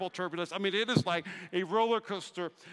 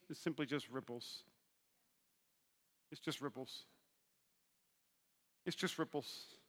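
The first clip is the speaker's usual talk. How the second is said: dropped to a near hush, letting it sink in; 7 semitones lower, 14 dB quieter than usual. Real, not acted. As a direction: say it softly to the congregation. Pastor quietly driving home point.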